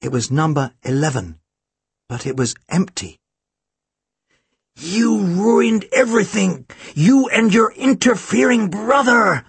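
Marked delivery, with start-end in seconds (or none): 4.8-9.4 venomous villain, high and drawn out; malevolent hiss. A narrator's reading. Villain blaming Tim and his interfering brother, malevolent hiss. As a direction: spit it out slowly with high-pitched malice. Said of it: "The voice was a malevolent hiss".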